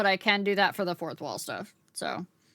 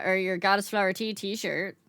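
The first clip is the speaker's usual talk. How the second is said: ordinary talk, nothing marked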